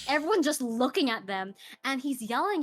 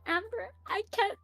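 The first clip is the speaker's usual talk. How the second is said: high, breaking tearful plea; retold crying; 11 semitones higher than usual. Teen coworker crying to her manager in the back, tearful high voice. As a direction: imitate a sobbing teen coworker, pitched way up.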